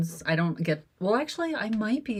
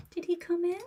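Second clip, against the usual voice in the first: pet voice; 7 semitones higher than usual. Real, not acted. Owner greeting her kitten, in high baby talk. Talking sweetly to her cats.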